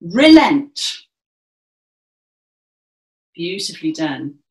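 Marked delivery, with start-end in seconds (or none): 0.0-1.0 loud relent, pitched up; projected relent. Shown as a demonstration. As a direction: proclaim relent to the back row. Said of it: "relent with our gesture".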